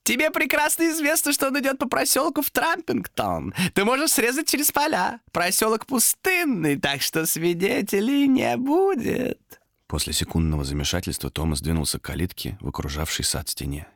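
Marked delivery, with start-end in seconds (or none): 0.0-9.4 very high creature voice, slowing; insinuating demon. Acted. Demon points Томас down an empty lane, insinuating. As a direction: tempt him, stretching the final words.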